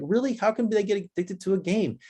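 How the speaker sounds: mildly mock a baffled parent; disbelieving parents. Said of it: "some parents are like".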